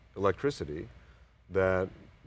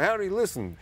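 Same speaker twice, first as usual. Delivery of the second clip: put-on higher-pitched, hearty Russian accent; thick Russian accent; 11 semitones higher, 3 dB louder than usual. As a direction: imitate an eager Russian-accented scientist. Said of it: "very thick Russian type accent".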